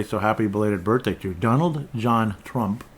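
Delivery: normal talking voice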